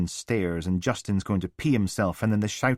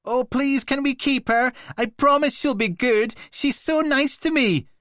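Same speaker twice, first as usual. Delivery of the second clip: much higher, whiny mocking child voice; mock the boy's pleading, high and whiny; 6 dB louder than usual. A narrator's reading. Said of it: "His voice goes all high and whiny".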